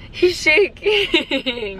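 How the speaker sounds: ordinary delivery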